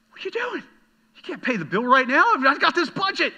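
mock upset outburst. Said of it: "I'm like".

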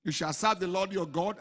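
normal talking voice

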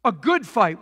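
ordinary talk, nothing marked

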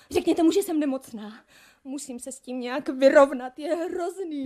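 loud frightened pleading; panicked plea. Acted. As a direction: plead urgently, close to breaking.

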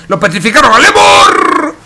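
sudden high shout; excited shout. Real, not acted. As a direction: yell the play out. Caster yells at a play.

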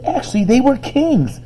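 high, encouraging correction; surprised, pleased. A narrator's reading. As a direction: correct him gently, pitched high. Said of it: "surprised and a little bit pleased".

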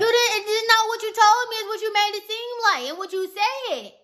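high, insistent arguing; heated correction. Real, not acted. Girlfriend correcting boyfriend's excuse in a quarrel, heated. Arguing over who misled whom.

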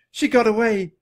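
high, loud, elated; joyful cry. A narrator's reading. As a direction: cry out with relief. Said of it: "cried Annie".

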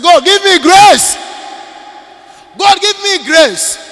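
loud tearful cry to God; crying plea. Acted. Woman in tears begging God for grace. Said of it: "And then she started crying".

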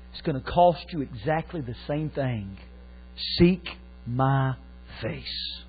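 fading to a whisper; hushed voice of God. Retold or imitated. God speaking to David, falling to a whisper. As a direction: let it sink to a breath. God's voice retold quietly.

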